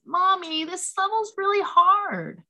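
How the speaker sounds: put-on high, loud little-kid whine, drawn out; four-year-old son. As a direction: imitate a small boy calling out to Mommy. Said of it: "all of a sudden he goes".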